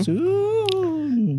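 high, long-held "soon"; drawn-out tease. Pretend. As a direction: stretch it out high like a teasing promise. Teasing echo of "very soon.

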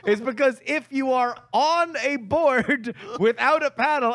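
high, emphatic, animated ranting; worked-up realization. Real, not acted. Storyteller realizing what the idiom really means, worked-up explaining.

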